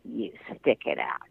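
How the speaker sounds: low, slow stern witch. Retold or imitated. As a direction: growl it like a witch. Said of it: "in her best witch voice".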